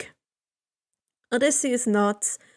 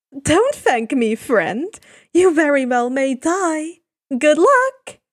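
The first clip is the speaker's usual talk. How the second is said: high, bright chirping farewell; 3 semitones higher, 6 dB louder than usual. Acted. Hermes chirps farewell to Odysseus, rising loud. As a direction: chirp the goodbye. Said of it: "He chirps".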